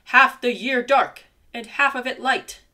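loud furious roar building; angry roar. A narrator's reading. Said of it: "He roared louder and louder".

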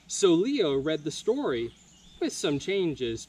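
plain reading voice

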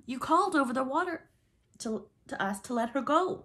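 high-pitched, stumbling excuse; nervous protest. A narrator's reading. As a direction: plead your case to the captain. Pirate nervously defends himself.

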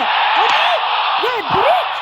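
high, loud urging cry collapsing into despair; excited yell. Real, not acted. Gamer yelling at an open goal then hitting the post, high loud cry. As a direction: scream him on, then groan at the post.

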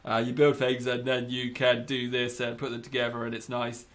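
a put-on low dopey narrator. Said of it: "Maybe I should talk like that".

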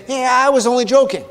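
lightly voiced careless excuse-maker; mock brush-off. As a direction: play the dodger shrugging it away. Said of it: "covers it by saying".

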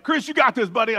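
high, loud encouragement; retold pep talk. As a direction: cheer on a nervous buddy. Group cheers on frightened friend.

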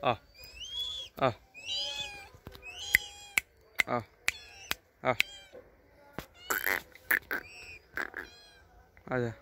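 short, spaced-out calls to a bird; calling birds. Real, not acted. Man coaxing kites down to the chicken, calling out. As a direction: call the kites over, one short cry at a time.

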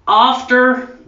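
high, loud American "After" with AH vowel and hard R; mock American straining at "After", R still showing; failed American attempt.

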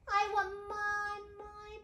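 put-on high child voice, slow, dying away; faltering little-girl demand; princess starting to wail. A narrator's reading. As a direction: begin a wail and let it tail off. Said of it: "began the little princess".

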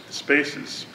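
normal talking voice

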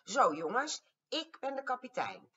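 high, loud squeaky little captain; a tiny mouse bossing the big dinos. A narrator's reading. Grandma voicing the bossy little mouse, squeaky and loud. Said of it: "Al had hij een piepstemmetje".

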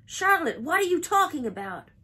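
high, loud alarmed question; crying out. A narrator's reading. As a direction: blurt it out in disbelief. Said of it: "he cried".

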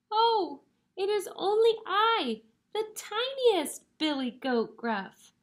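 high-pitched tiny billy goat; very small voice. Acted. Tiniest goat answers the troll, very small voice. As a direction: squeak it out like the littlest goat. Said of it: "in his very small voice".

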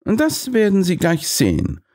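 high, assured detective voice; confident Holmes. A narrator's reading. Holmes tells Overgrunge, high and sure of himself. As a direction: tease what comes next.

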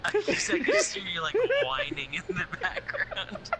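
laughing. Real, not acted. Laughing fit while gaming.

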